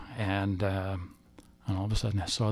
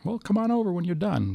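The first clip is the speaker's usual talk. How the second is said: friend's invitation; 10 semitones higher than usual. Retold or imitated. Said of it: "I got a story to tell you".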